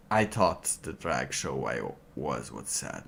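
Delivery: ordinary talk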